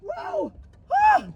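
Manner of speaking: high, drawn-out cries rising to a yelp; mock startled cries. Pranking at the drive-thru, mock startled cries. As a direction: act terrified of the horn. Repeated fake scares.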